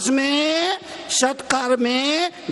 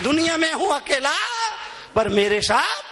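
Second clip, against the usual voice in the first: raised call, then dropping back; called-out prayer; 5 semitones higher than usual. Real, not acted. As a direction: prompt the crowd to finish it. Same line called out to crowd. (ऊँची पुकार, फिर उतरता स्वर)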